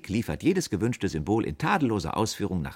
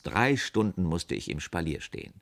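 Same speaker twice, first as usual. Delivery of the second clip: plain reading voice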